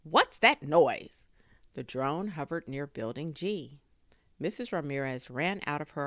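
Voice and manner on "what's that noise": loud, slow, indignant question; complaining. A narrator's reading. Neighbour at her window about the racket, loud complaining. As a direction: stretch out an annoyed complaint. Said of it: "opened her window to complain".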